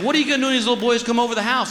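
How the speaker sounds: high, fast, fretful wife voice; mock wife. Wife asking husband about the boys, high fast mock question. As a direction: rattle it off high like a worried wife.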